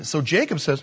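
ordinary talk, nothing marked

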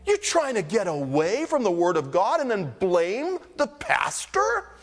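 high retort leaping to a squeak on PASTOR; incredulous. Acted. Pastor challenging people who blame him for God's word, incredulous. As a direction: challenge them in disbelief, pitch soaring at the end.

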